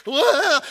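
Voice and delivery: loud high panicked yelp. Retold or imitated. Nervous flyer hears the safety drill, yelping. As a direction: yelp in mock terror. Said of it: "you start to".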